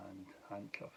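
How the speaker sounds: breathy aside, almost no voice; whispered nickname. Real, not acted. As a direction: drop to a secret whisper on the name. Blurting out his embarrassing nickname.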